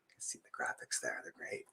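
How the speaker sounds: soft unvoiced whisper; whispered aside. Real, not acted. Reader whispering an aside about the pictures to listeners. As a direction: whisper it off to the side.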